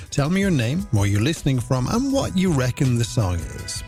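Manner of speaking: ordinary talk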